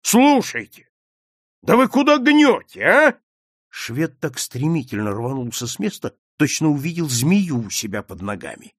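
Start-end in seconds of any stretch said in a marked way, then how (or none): 0.0-3.2 high, loud indignant challenge; shouting. A narrator's reading. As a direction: shout it in alarmed protest. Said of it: "крикнул он". (возмущённый крик)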